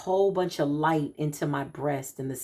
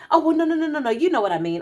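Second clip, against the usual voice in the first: much higher, louder flustered protest; flustered backpedal; 10 semitones higher, 6 dB louder, much faster than usual. Retold or imitated. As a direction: imitate the friend scrambling to take it back. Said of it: "I said".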